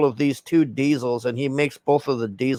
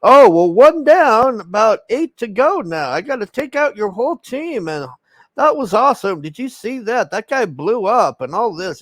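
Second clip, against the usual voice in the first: campy taunting mock voice, pitched way up; Jason Momoa; 8 semitones higher than usual. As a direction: imitate a giddy, gloating madman. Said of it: "laughing, and he's like".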